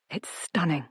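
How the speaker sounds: soft exclamation, understated; hushed exclamation. A narrator's reading. Grace admires the garden, hushed exclamation. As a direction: exclaim it softly, as if in awe. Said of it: "I exclaim".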